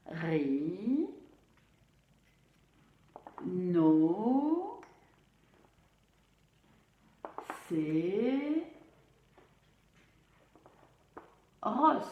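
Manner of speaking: "Ri, no, c'est, ros" with long pauses between syllables. Shown as a demonstration. Teacher saying a difficult word for pupils, one syllable at a time. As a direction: stretch each of "Ri, no, c'est, ros" and wait after it. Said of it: "pour bien redire les syllabes".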